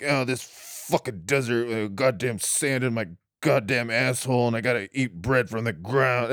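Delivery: low, soft mock grumble; grumbling. Israelite complains in the desert, grumbling low. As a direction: grumble under your breath, low and slightly slowed. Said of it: "that's the grumbling that I imagine".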